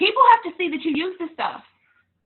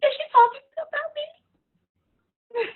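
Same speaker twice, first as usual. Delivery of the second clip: high, slow mock whine; paranoid follower; 13 semitones higher, much slower than usual. Coach imitating a worried customer in a high, slow voice. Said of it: "they would've been like".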